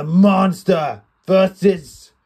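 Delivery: slow, drawn-out announcer call of the name; mock ring announcer hyping the matchup. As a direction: stretch Drew McIntyre out like a ring announcer. Ring announcer style introduction.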